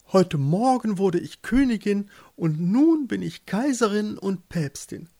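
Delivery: high, sweeping proclamation; grand lady pope, boastful. A narrator's reading. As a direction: list your titles grandly, pitched high. Voices the pope Ilsebill. (stolze Päpstin, hoch verkündend)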